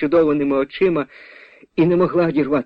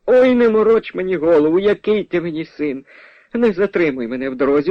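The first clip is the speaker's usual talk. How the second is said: high-pitched, impatient scolding; scolding mother; 6 semitones higher than usual. Acted. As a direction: brush off the stranger crossly. Mother scolds the claimed son.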